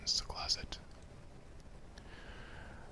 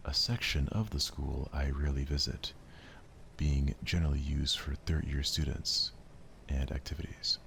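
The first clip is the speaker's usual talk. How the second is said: measured narrator voice; story narration; 4 semitones lower, 4 dB louder than usual. A narrator's reading. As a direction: set the scene calmly. Narration of visual novel.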